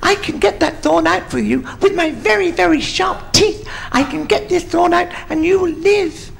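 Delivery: tiny high-pitched mouse voice; little mouse. Acted. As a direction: pipe up as a small helpful mouse. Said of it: "this little mouse came and said".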